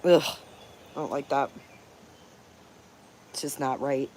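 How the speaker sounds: near tears. Real, not acted. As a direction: let it sink almost to nothing. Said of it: "I'm almost in tears talking about it".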